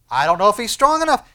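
loud, high objection; acted doubt, pushed up in pitch; doubter's protest. Preacher voicing doubt of God's strength, loud protest.

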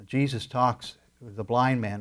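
ordinary talk

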